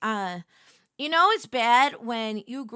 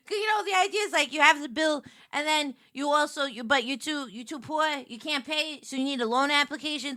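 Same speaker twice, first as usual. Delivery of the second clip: ordinary talk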